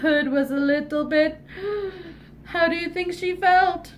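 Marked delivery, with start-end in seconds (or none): 1.4-2.1 sharp frightened intake of breath; scared gasp. Acted. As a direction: gasp at the sight of the wolf. Red sees the wolf.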